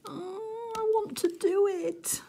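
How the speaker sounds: high, squealing want; giddy longing. Real, not acted. To viewers about a diamond painting retreat, high squeal.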